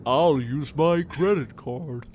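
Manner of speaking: slow, warped voice changer voice; Macaulay Culkin talk boy. Retold or imitated. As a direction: drag it out like a toy voice changer. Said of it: "You sound like Macaulay Culkin in Home Alone when he has that talk boy voice changer".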